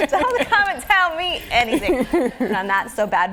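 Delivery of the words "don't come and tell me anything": laughing defiance. Real, not acted. Playful defiance about being told.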